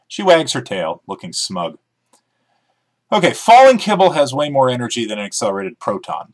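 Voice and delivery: usual speaking voice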